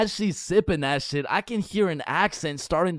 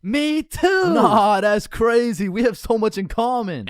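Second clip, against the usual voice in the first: excited date; 5 semitones higher, 4 dB louder than usual. Acted.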